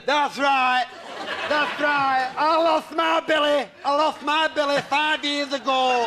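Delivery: put-on high, loud, slow old lady lament; mock-mournful old widow. Lena the widow mourns her Billy at the wake, high and slow. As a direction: wail it like a grieving gran at a wake. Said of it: "Isn't that right, Lena?".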